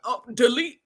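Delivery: ordinary delivery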